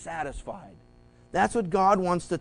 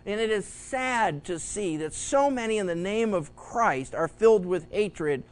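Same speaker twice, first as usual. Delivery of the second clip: normal talking voice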